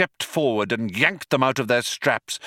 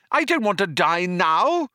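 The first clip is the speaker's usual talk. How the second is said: high, wailing protest; yelled; 9 semitones higher than usual. A narrator's reading. Arthur being seized by the guard, yelling. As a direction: yell it up high, rising at the end. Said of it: "he yelled".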